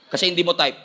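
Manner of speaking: normal talking voice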